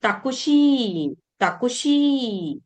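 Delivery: ताकुशी said slowly, syllable by syllable; teacher modelling ताकुशी slowly; slow Japanese pronunciation. Shown as a demonstration. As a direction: spell out ताकुशी carefully as ta-ku-shi. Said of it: "taxi का किया है ताकुशी".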